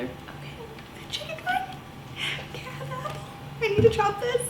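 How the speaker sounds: tiny high-pitched silly voice; squeaky voice. Pretend. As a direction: squeak like a cartoon.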